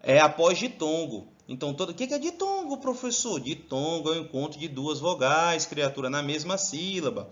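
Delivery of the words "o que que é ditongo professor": high, quick imitation of a puzzled student; mock student question, pitched well up; student asking. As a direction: pipe up as a pupil questioning the teacher. Teacher voices a student's question.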